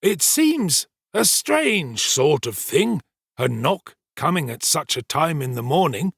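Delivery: self-important storyteller Giles. Acted. Servant retelling the night's alarm to the kitchen, pompous storytelling. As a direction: hold forth slowly, relishing the tale.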